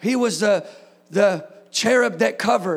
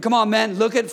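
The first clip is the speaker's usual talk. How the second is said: ordinary delivery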